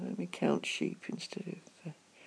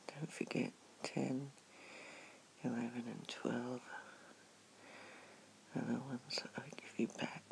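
ASMR whisper; 4 semitones lower, 9 dB quieter than usual. Acted. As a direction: whisper gently at the bedside. Soft bedside comforting whisper.

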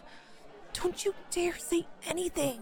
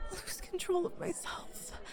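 breathy whispered confession; 10 dB quieter than usual. Acted. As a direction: whisper it, barely holding on. Flustered, losing composure.

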